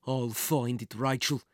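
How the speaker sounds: low villainous vow, mildly angry; cursing. Acted. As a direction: curse under restrained anger. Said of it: "he cursed".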